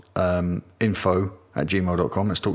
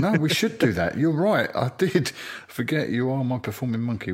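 mock eager agreement; 5 semitones higher than usual. Playful mock agreement, far.

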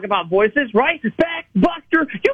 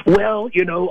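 usual speaking voice

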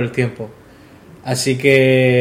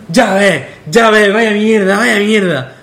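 put-on slurred, slow and raised like a drunk; drunk friend; 6 semitones higher, 3 dB louder, slower than usual. Drunk friend slurring his disgust at the singer.

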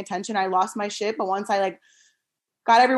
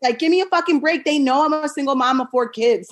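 much higher and louder, fed-up protest; indignant retort; 7 semitones higher, 5 dB louder than usual. Retold or imitated. Single mom to judgmental neighbor, loud indignant retort. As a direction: throw it back at the judging neighbor. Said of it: "And I'm like".

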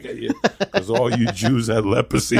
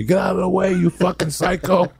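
angry driver; 8 semitones higher than usual. Pretend. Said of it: "People beeping at me".

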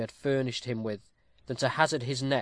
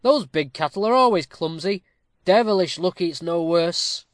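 raised, louder exclamation after a shock; thunderstruck; 4 semitones higher, 7 dB louder than usual. A narrator's reading. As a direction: comment loudly, still amazed. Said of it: "who had been thunderstruck".

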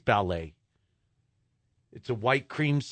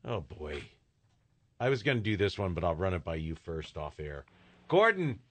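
ordinary delivery

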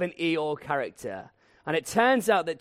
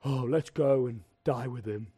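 lower, mopey Eeyore voice; gloomy Eeyore; 4 semitones lower than usual. Retold or imitated. Thomas as Eeyore, gloomily volunteering to die. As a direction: sigh it out like a glum donkey. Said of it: "he's like".

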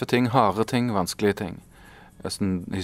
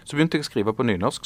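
ordinary talk

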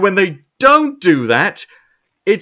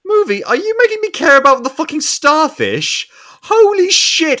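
high, loud incredulous cry; mock disbelief; 10 semitones higher, 4 dB louder than usual. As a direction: exclaim as if betrayed by the movie. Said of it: "And I was like".

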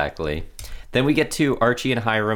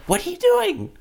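a much higher, squeaky incredulous question. Retold or imitated. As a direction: react as if you can't believe it. Mock reaction to character.

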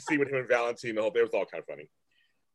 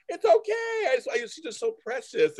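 higher, sugary mock of a doting tone; sweet mock voice; 12 semitones higher than usual. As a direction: gush sweetly to cover it, lightly mocking. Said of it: "and I was like".

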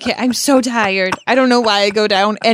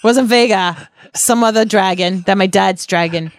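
ordinary talk, nothing marked

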